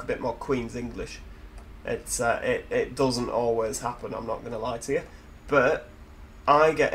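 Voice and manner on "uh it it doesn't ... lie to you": ordinary talk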